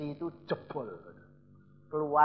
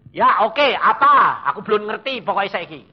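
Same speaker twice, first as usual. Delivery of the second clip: loud, raised-pitch acted retort; impatient reply; 6 semitones higher, 13 dB louder than usual. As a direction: push back, loud and insistent. Acts his impatient answer. (jawaban tak sabar, suara lantang)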